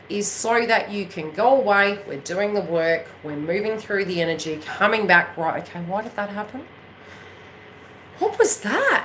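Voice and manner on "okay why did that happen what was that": soft question, then much higher; voiced puzzlement. A viewer asks themselves what happened, puzzled. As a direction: puzzle it over, rising to a high query.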